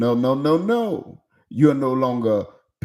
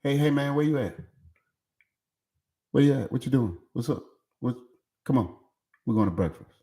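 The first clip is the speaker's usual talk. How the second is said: dad popping up; 5 dB quieter, much faster than usual. Retold or imitated. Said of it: "I'm popping up at the school".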